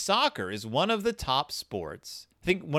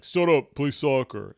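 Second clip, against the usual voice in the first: referee's yell; 6 dB louder than usual. Retold or imitated. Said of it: "the referee just yells at him".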